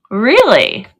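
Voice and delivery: usual speaking voice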